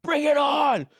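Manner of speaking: high, loud battle cry; rally for the fight; imitating his wife's fighting shout; fired-up shout. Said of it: "she was like".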